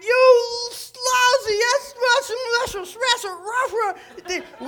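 high, loud garbled cursing, drawn out; hammed-up angry driver sputtering nonsense; mock road rage. Driver at someone pulling out in traffic, mock road rage.

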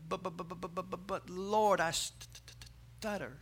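soft stammer, "but" repeated in a string; stuttering Moses. Acted. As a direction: quietly stick on "st-" before "stutter" comes out. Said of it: "Because he stuttered?".

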